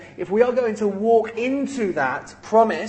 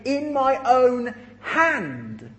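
"In my own hand" drawn out slowly, a little higher; slow emphasis; 5 semitones higher, much slower than usual. Retold or imitated. Preacher lingering on Paul's words, slow and emphatic. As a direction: lean on each word of "In my own hand". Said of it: "Paul goes on to say".

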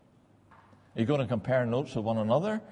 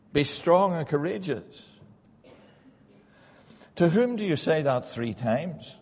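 higher, driving pulpit voice; fervent preaching; 6 semitones higher, 3 dB louder, slower than usual. Acted. Preacher pressing listeners on who needs courage, fervent. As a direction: lift it and press the question.

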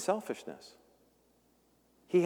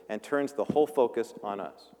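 ordinary delivery

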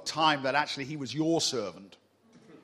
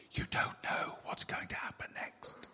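whisper; 9 dB quieter than usual. Real, not acted. Whispered aside to congregation.

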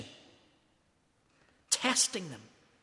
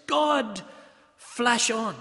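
imitating the Guguletu minister's accent, raised and punchy; Spiwo Kapili's accent; 3 semitones higher, 6 dB louder, much slower than usual. Preacher quoting his Guguletu minister, in that minister's accent. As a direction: say God flesh on in his Guguletu accent, high and loud. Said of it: "in that wonderful accent of his".

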